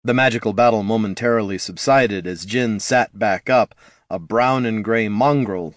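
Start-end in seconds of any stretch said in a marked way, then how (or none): none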